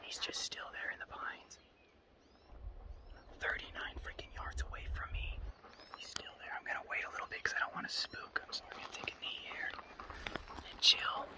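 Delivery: hushed whisper so the buck won't spook; breathless whisper, thrilled by the buck; wildlife watching whisper. Real, not acted.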